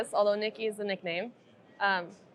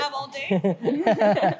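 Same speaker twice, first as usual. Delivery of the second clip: very slow, drawn-out words with a laugh; laughing joke; 2 semitones higher, 3 dB louder, much slower than usual. Real, not acted. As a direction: stretch it out teasingly, laughing. Jokes about time for backstory.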